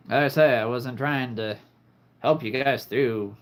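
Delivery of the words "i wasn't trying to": ordinary talk, nothing marked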